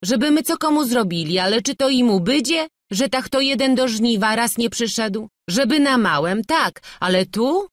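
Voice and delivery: high, loud self-justifying; indignant shrugging-off; dismissive protest. A narrator's reading. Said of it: "mówili, wzruszając ramionami".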